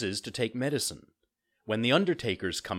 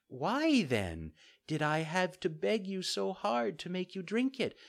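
fairy, gently reproachful; 10 semitones higher than usual. Acted. Fairy figure in story.

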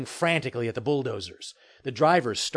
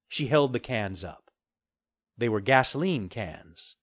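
usual speaking voice

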